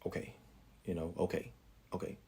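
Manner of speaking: barely audible, backing off; hushed resigned okay, almost voiceless; quiet okay. Retold or imitated. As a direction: murmur okay while retreating. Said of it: "So I'm like".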